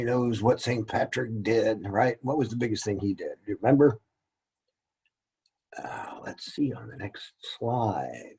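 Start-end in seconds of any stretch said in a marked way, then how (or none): 5.8-8.4 slow unvoiced whisper to himself. Real, not acted. Teacher whispers to himself before the next slide. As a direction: whisper it as an aside while checking the slide. Aside while checking the slide.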